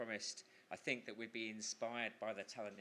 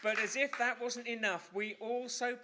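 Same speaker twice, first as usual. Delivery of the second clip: big raised announcer voice; high, loud showman announcement; announcer call; 11 semitones higher, 6 dB louder than usual. Real, not acted. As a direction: boom it out to the room. Host announcing to crowd.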